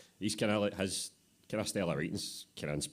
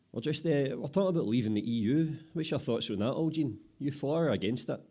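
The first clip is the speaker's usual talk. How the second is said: eager apprentice; 8 semitones higher, 5 dB louder than usual. Acted. Apprentice asking the boss.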